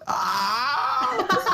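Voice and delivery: shrill mock scream of fright; high scream. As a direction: shriek as if the ghost appeared. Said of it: "I'm just like".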